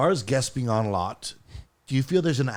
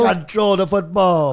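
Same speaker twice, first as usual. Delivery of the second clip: put-on slow, loud Jamaican accent; hammed-up Jamaican accent; 7 semitones higher, 7 dB louder, slower than usual. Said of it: "That is Jamaican".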